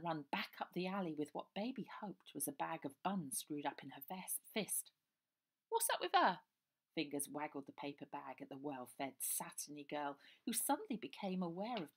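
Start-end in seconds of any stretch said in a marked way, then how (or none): 5.7-6.4 put-on high, loud child voice. A narrator's reading. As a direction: ask it like a cheeky kid. Child asks about girl.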